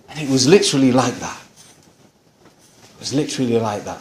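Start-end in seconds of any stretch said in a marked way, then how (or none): 2.9-4.0 softer and faster, almost to himself; quiet fast repeat. Real, not acted. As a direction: drop the volume and rush the echo. Repeats the line for emphasis.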